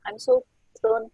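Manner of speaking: usual speaking voice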